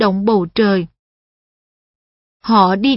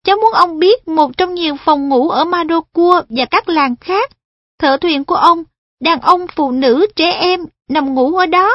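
put-on higher, eager young boy; a polite boy guiding an elder; earnest boy voice; 6 semitones higher than usual. Perrin explains earnestly.

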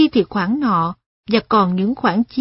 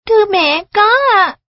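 put-on high, loud young child voice; 10 semitones higher, 6 dB louder than usual. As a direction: answer like an eager child. Child answers mother.

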